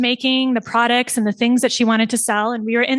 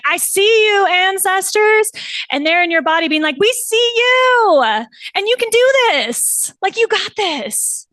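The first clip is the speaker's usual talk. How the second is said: voicing the ancestors; 9 semitones higher, 3 dB louder than usual. Voicing ancestors and client calling.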